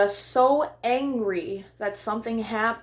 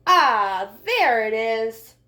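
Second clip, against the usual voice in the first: bright, high storybook read-aloud; lively picture book voice for young listeners; reading to children; 6 semitones higher, 3 dB louder than usual. Acted. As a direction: read it like story time for little kids. Reads picture book to kids.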